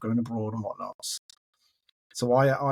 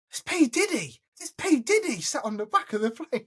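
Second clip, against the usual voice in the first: very high-pitched astonishment; starstruck disbelief; 12 semitones higher than usual. Retold or imitated. Passenger spotting [name] behind him, high starstruck disbelief. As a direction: squeal it as an amazed discovery. Startled at celebrity on small plane.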